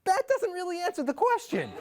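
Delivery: ordinary delivery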